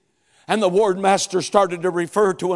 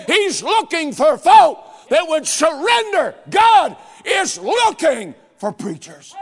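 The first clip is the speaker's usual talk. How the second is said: loud, high, drawn-out preacher's cry; full-force preaching; 10 semitones higher, 6 dB louder than usual. Acted. Preacher crying out to the congregation for men. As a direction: call out to the congregation.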